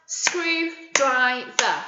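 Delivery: very slow, each syllable apart; syllables spaced for clapping; clapped out. Shown as a demonstration. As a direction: clap each beat slowly. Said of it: "Let's clap it out".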